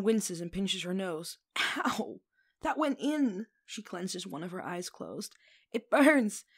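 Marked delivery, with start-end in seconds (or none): 1.6-3.5 pained yelp. Acted. Wine up her nose. 5.7-6.4 loud cry of stinging pain; loud, pained wail; pained yell. Acted. Wine burning her nose.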